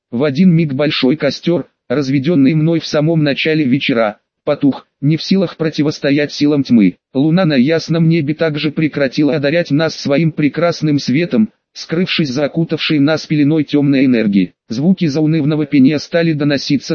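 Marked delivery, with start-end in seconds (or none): none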